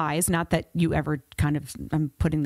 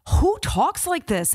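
incredulous; 11 semitones higher, 3 dB louder, slower than usual. Retold or imitated. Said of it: "I read it and I'm like".

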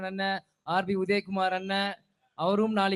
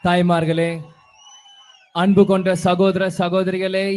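thunderous political oratory; 3 semitones lower, 6 dB louder than usual. Real, not acted. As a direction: boom it out to the crowd from the stage. Formal greeting opening stage speech.

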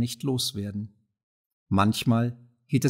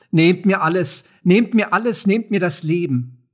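raised, high, desperate outcry; desperate screeching; 6 semitones higher, 7 dB louder than usual. A narrator's reading. Poultry seller throwing out her wares, screeching in despair. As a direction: cry out as if robbed of everything. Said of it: "Aus dem Kreischen fiel sie".

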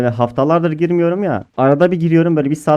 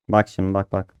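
ordinary talk, nothing marked

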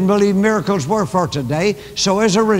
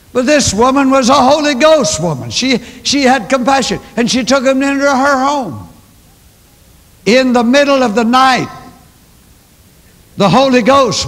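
louder, higher impassioned preaching; fervent preaching; 6 semitones higher, 6 dB louder than usual. Acted. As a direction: preach the story with fire. Preaching at full force.

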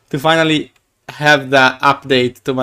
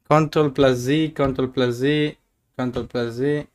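fading softer each time; muttering; 2 semitones lower, 7 dB quieter than usual. Real, not acted. Streamer mutters an undo to himself, getting softer. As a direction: mumble it to yourself.

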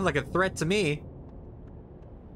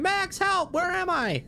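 high-pitched mock cry for help; hammed-up damsel-in-distress wail; mock screaming; slower than usual. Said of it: "[name] was screaming".

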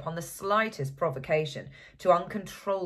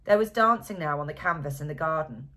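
usual speaking voice

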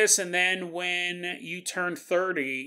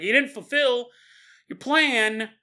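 mock demon scolding; 8 semitones higher, 5 dB louder, slower than usual. Demon scolds damned soul.

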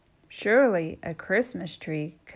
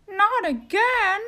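high, startled turkey voice; alarmed turkey; 13 semitones higher, 6 dB louder than usual. Acted. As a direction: panic at being dinner again.

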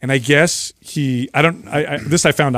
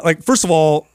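ordinary talk

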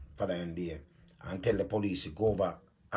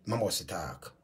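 ordinary talk, nothing marked

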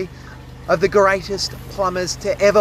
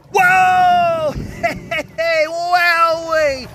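high, loud whoa, then a laugh and a slow wowee; thrilled whoop; 8 semitones higher, 3 dB louder, much slower than usual. Real, not acted. Plumber cheering as the blocked drain explodes clear, high and loud. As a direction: let the joy burst out as the drain clears.

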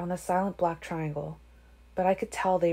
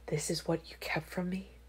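hushed, much softer voice; whispered; 9 dB quieter than usual. A narrator's reading. He asks over the child's head, whispered. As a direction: whisper the question under your breath. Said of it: "he whispered over her head".

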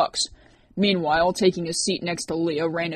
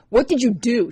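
sharp, louder, higher accusation; snapped; 6 semitones higher, 7 dB louder than usual. A narrator's reading. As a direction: snap it at the culprit. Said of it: "she snapped at Rourke".

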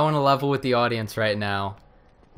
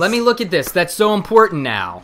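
high loud sarcastic enthusiasm; mock excitement; 6 semitones higher, 6 dB louder than usual. Co-host mocking the article, high loud sarcasm. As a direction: gush sarcastically.

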